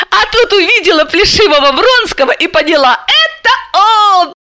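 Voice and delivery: смеясь, высоко, громче; смеясь над собственной шуткой; со смехом. A narrator's reading. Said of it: "рассмеялась Алиска". (сквозь смех)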